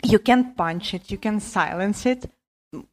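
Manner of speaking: usual speaking voice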